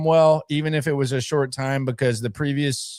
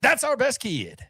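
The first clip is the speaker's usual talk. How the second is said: much higher mock GM outcry; Chris Ballard voice; 11 semitones higher than usual. Host tells fans to greet the GM with his own yell. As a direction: say it like the GM would, hammed up. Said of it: "just say".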